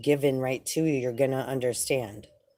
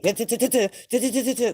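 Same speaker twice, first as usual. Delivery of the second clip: high, rapid du-du-du babble; mock mental chatter; 7 semitones higher, 3 dB louder, faster than usual. Showing viewers the ego's chatter, high mock babble. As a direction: rattle off the noise in your head.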